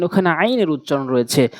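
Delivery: ordinary talk, nothing marked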